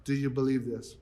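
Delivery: soft, hushed ask; Jesus quietly asking Martha; simple quiet question. Retold or imitated. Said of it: "he simply asked her".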